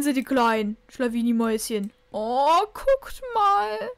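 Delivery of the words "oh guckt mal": high, louder cooing; delighted squeal. Real, not acted. To the viewers about the kittens, delighted squeal.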